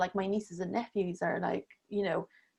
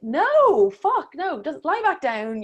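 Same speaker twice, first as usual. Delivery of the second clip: loud, higher retold 'no' to yourself; emphatic refusal; 6 semitones higher, 7 dB louder, slower than usual. Woman recounts telling herself to stay down, emphatic refusal. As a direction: firmly talk yourself out of it, pitched up.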